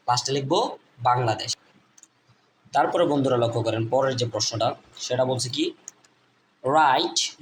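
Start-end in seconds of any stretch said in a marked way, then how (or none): none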